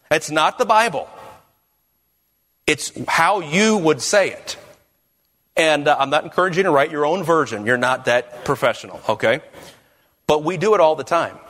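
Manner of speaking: ordinary delivery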